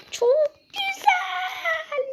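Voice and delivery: "Çok güzel" whined very high and slow, half sobbing; mock crying. Real, not acted. Gamer to viewers after failing again, mock-sobbing "Çok güzel". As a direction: drag out "güzel" like he's about to cry. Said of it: "Ağlayacağım ya".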